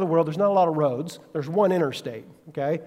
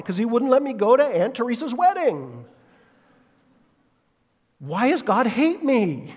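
higher, aggrieved complaint; aggrieved traveler; 7 semitones higher than usual. Retold or imitated. Stranded traveler complaining to the pastor, mock aggrieved. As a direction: plead for sympathy. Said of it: "And they said".

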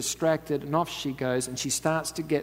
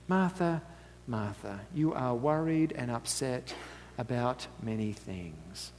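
soft, slow, grave words; gentle serious rebuke; 3 semitones lower, 6 dB quieter, slower than usual. Acted. Jesus gently rebuking worried Martha, soft and slow. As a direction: lower and slow down, gravely tender. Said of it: "great seriousness about the moment".

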